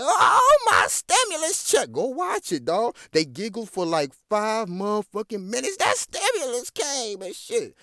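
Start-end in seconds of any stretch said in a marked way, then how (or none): 0.0-1.9 very high, loud mock squeal. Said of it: "She was saying". 5.8-7.8 mock giddy voice. Mocking the guest's glee.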